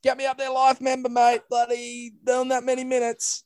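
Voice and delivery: high, hammed-up self-important voice; mock boastful. Joking to his co-host about being a life member, hammed-up and high. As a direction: play up the big shot, pitched high.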